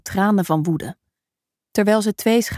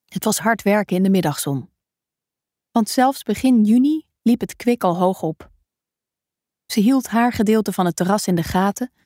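plain reading voice

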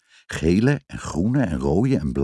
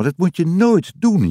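higher-pitched, emphatic telling-off on "nooit"; motherly warning; 9 semitones higher, 4 dB louder than usual. A narrator's reading. Mama warning Jip after the scare, emphatic telling-off. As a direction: warn firmly, pitched up, leaning on "nooit".

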